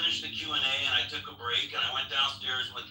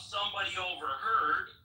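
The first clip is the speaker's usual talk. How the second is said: normal talking voice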